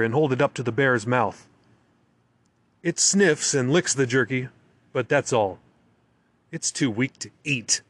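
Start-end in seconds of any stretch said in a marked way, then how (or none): none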